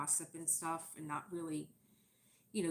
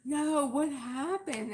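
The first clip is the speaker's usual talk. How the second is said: pushy gossip; 9 semitones higher, 11 dB louder, much slower than usual. Retold or imitated. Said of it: "'Cause they go".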